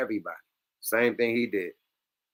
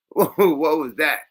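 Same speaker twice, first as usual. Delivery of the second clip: laughing, slow, incredulous; 3 semitones higher, 5 dB louder, slower than usual. Real, not acted. Replaying the clip, laughing in disbelief. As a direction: laugh through the question.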